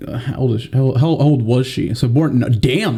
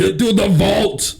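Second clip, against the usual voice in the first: slow, higher, mock Austrian accent; 7 semitones higher, slower than usual. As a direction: drag it out like a hammed-up Austrian action hero. Joining the Arnold banker bit.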